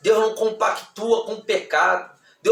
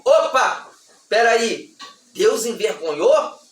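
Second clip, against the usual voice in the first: normal talking voice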